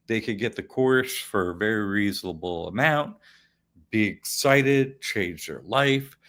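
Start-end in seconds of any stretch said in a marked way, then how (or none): none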